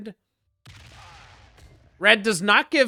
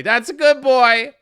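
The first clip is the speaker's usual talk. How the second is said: higher, louder, slow pet praise; talking to the dog in a slow, high, louder praising voice; 4 semitones higher, 7 dB louder than usual. Pretend. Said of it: "My dog didn't hear".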